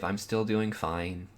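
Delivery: usual speaking voice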